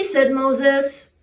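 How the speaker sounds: plain reading voice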